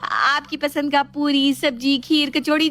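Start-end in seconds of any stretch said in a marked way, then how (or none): none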